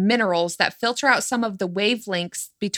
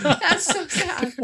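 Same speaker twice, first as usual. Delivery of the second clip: laughing; 6 semitones higher, slower than usual. Real, not acted. Laughing at friend's complaint.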